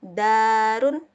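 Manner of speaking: Darun said loud and clear, d held firm; teacher pronouncing Darun; example word. Shown as a demonstration. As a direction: model Darun for students. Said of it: "Ini contoh dari huruf dal".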